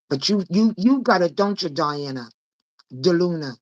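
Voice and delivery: ordinary talk, nothing marked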